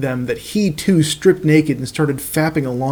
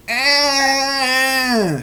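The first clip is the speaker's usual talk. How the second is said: high cry of agony; high agonised scream; 10 semitones higher than usual. Acted. Caillou losing his penis, high scream of agony. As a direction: yelp up high in pain. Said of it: "screamed Caillou in agony".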